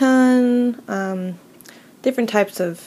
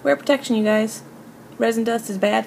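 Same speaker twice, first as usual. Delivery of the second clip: emphatic; 3 semitones higher, 5 dB louder than usual. Real, not acted. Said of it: "please use protection".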